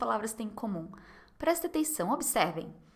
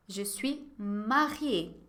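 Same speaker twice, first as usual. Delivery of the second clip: "Je suis mariée" very slow, falling at the end; very slow model answer; 2 semitones lower, much slower than usual. Shown as a demonstration. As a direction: say "Je suis mariée" slowly for the class. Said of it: "la réponse sera toujours négative". (resposta bem devagar, caindo no fim)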